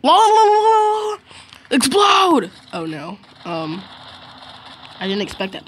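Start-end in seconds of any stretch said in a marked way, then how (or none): 0.0-1.2 loud wailed la-la. Real, not acted. Wordless outburst during game play. 1.7-2.5 high, loud game shout; excited yell. Real, not acted. Yelling at an in-game explosion.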